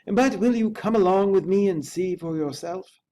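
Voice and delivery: high, loud, fearful servant voice; a scared manservant, high and loud; frightened butler. A narrator's reading. Poole's frightened voice.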